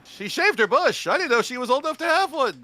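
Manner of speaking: a mock Seinfeld joke; Jerry Seinfeld impression. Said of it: "Jerry Seinfeld, probably".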